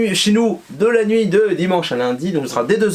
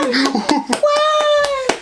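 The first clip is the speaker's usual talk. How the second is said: high shouted cheer; crowd cheer. Acted.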